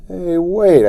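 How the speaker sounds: high, loud call after them; crying out. Acted. Boy calling after his running brother. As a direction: call out to make them wait. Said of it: "I cried".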